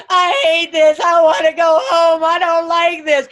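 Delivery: put-on loud, high-pitched child's wail; whining kid. Tired kids at Disney at day's end, loud whining. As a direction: wail it like an overtired kid in a mock tantrum. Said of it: "they're just whining".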